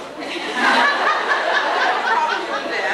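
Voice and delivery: high, loud laugh that falls away; real embarrassed laughter; long loud laugh. Real, not acted. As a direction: laugh off the awkward wait. Waiting on the broken tape.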